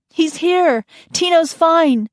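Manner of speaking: very high, loud call; joyful call. Acted. Nanny calling to the searchers, joyful. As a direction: shout the good news.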